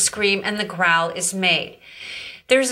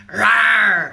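loud growling lion rawr; 5 dB louder than usual. Shown as a demonstration. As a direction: roar like a lion. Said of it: "we're gonna be lions growling".

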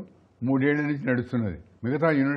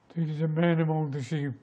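slow, measured reply in natural register; 3 dB quieter, much slower than usual. Shown as a demonstration. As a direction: say it gravely and unhurried, without raising pitch. Film scene, second character repeats.

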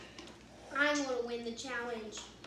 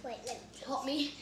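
usual speaking voice

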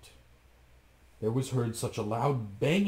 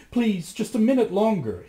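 much higher, louder plea; pleading wife; 10 semitones higher, 7 dB louder than usual. A narrator's reading. Wife begging Bluebeard for more time. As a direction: beg for a moment more. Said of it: "his wife answered".

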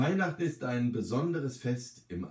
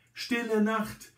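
high, drawn-out exclamation; sudden call-out; 12 semitones higher, slower than usual. A narrator's reading. Old resident suddenly names the carol to sing, high sudden call. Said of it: "ruft sie plötzlich".

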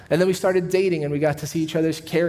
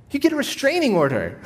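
laughing punchline; 11 semitones higher than usual. Real, not acted. Punchline about a stranger proposing.

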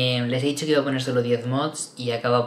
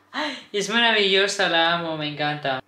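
delighted gush; 6 semitones higher, 6 dB louder than usual. Real, not acted. Gushing over the new case.

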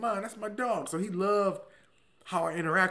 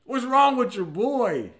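slow, raised, baffled question; puzzled friend; 10 semitones higher, 10 dB louder, slower than usual. Retold or imitated. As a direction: drag it out, bewildered. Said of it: "He said".

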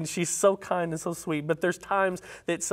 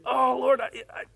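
higher, humble plea not to anger God; humble pleading; 7 semitones higher than usual. Acted. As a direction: plead humbly, afraid to push. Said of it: "Abraham is showing that humility here".

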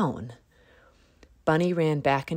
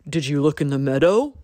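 quick, louder deer voice; 6 dB louder, faster than usual. A narrator's reading. Deer quizzes Bunny quickly, louder voice. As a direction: rattle off the question. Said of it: "asked Deer".

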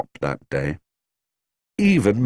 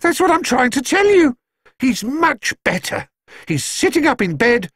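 flustered housekeeper. A narrator's reading. Mrs Bird voiced, relieved.

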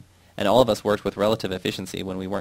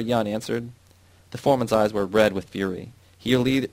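ordinary delivery, nothing marked

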